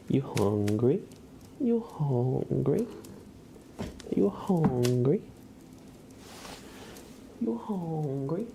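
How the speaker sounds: high, drawn-out rising question; cat-teasing singsong. Real, not acted. Owner teasing cat about food, in high drawn-out singsong. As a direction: stretch it out in a high singsong, swooping up at the end. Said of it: "I always say it like that".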